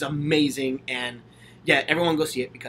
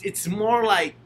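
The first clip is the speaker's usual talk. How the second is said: ordinary delivery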